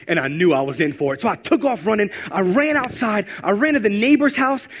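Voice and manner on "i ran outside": ordinary talk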